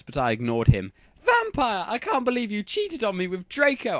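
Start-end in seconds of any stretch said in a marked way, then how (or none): 1.2-4.0 high, loud mock-outraged shout; hammed-up betrayed girlfriend. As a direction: accuse him in front of the class. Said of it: "I shouted at him".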